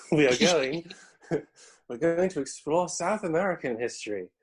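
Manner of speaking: mock kids' TV presenter, breaking into laughter; CBBC presenter voice. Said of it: "we say in our best C B B C, uh, presenter voice".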